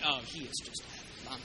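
lightly voiced doubter mocking the giant; dismissive. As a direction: brush him off with a low sneer. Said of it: "I have some going".

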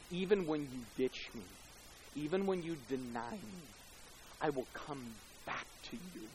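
a quiet promise of return; tender promise. Retold or imitated. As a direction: hush it down like a comfort.

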